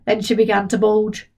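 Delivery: plain reading voice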